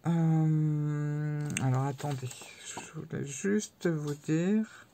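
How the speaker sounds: holding back hiccups, slow; words squeezed between hiccups; suppressing hiccups. Real, not acted. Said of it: "j'ai le hoquet".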